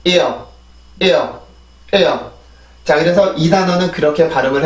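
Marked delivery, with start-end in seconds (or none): 0.0-2.2 "ear" stressed at the start, falling away as it relaxes; isolated model "ear", falling, with pauses; tense-then-release demo. Shown as a demonstration. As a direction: hit "ear" then let it glide down. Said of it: "다시 한번".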